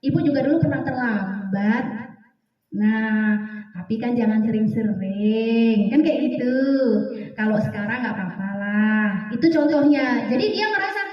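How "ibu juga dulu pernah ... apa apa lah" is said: calm them before any correction; gentle reassurance. Shown as a demonstration. Teacher reassuring a late pupil.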